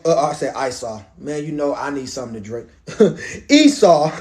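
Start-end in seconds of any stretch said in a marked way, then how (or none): 3.0-4.2 chuckling through the words; real laugh breaking up the reading; amused laughing. Real, not acted. Preacher laughing at his own misreading, amused.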